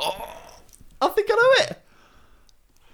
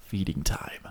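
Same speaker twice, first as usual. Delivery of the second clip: whispering; 3 semitones lower, 8 dB quieter, slower than usual. Pretend. Joke whispered after laughing.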